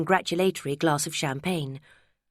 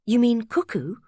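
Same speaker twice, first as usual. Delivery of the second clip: rising, louder startled question; surprised; 6 semitones higher, 3 dB louder than usual. A narrator's reading. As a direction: caught off guard, pitch jumps up. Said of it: "she said in surprise".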